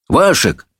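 brisk summoning cry; calling messenger. A narrator's reading. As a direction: call out to the boy. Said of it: "крикнул гонец".

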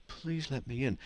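meek gentle Jesus. Retold or imitated. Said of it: "a gentle, gentle guy".

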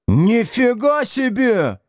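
high, drawn-out wail of outrage; Градусов. A narrator's reading. Pupil sees his bag emptied out, wailing. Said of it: "завопил Градусов".

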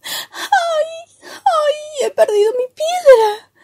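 high, sobbing lament; tearful storybook wail, pitched high; inconsolable acted crying. A narrator's reading. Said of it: "llora desconsolado".

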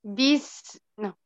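usual speaking voice

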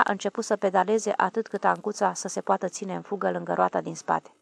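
plain reading voice

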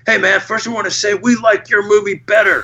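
put-on high, eager teenage fan voice; excited young fan. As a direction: gush like a starstruck teen fan. Fans gushing at the Q and A.

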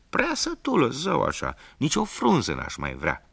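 high, loud bleating goat; a smug goat boasting. Acted. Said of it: "Și capra răspunse".

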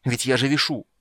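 irritated, urgent complaint; angry grumble. A narrator's reading. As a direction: protest crossly under strain. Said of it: "злое приглушённое ворчание".

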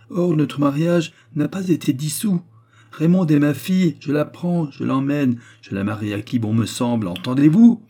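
marteler ses droits, haut et fort; revendication indignée. A narrator's reading. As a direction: tirade autoritaire finissant en question montante. Mari revendiquant ses droits avec colère. (revendication indignée)

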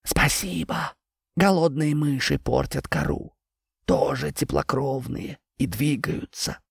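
quiet, slow tree voice; talking tree. Acted. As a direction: speak like an ancient tree, hushed. Tree character voice. (говорящее дерево)

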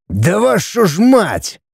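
drawn-out, high-pitched exasperated curse; angry roar. A narrator's reading. Father losing patience with family, angry. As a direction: snap with stretched-out fury. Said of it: "сердито рявкнул Олег Павлович".

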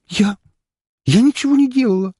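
high, sobbing, terrified plea; sobbing denial. A narrator's reading. Frightened girl denies attack to the count, sobbing. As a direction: sob it out in terror. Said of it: "всхлипнула она дрожащим голосом".

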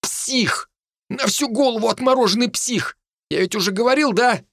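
high-pitched, rattled scolding; shaken, high outrage; indignant rant. Acted. Hinnigan berates friend after scare.

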